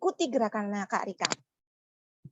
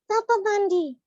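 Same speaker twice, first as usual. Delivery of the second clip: put-on squeaky child puppet voice, confused; high puppet voice; 10 semitones higher, 5 dB louder than usual. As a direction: play the little puppet mishearing. Puppet voice of Mulia.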